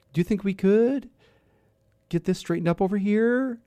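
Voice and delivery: singsong retail manager rising on sentence ends; imitating uptalk. Host imitating old department store managers, mock uptalk. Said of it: "they all went up at the end of their sentences".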